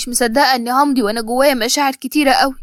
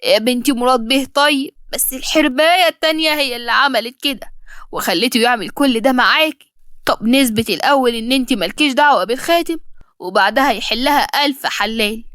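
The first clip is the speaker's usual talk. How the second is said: ordinary delivery, nothing marked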